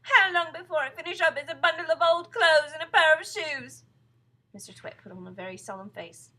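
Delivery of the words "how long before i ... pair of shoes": high, loud panicked wail; plead, terrified of the answer; frightened cry. A narrator's reading. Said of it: "cried Missus Twit".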